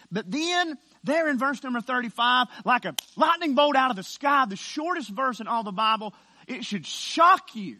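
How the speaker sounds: emphatic preaching. Acted. Preacher announcing the shortest Bible verse, emphatic preaching. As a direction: herald the verse, hitting the last words hard.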